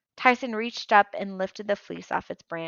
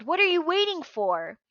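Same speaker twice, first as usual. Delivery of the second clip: impatient yell; 10 semitones higher, 4 dB louder than usual. Acted. Clarice urging Percy.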